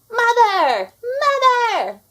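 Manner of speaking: high, loud shrieking call for mother; screaming. A narrator's reading. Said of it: "screamed Anastasia".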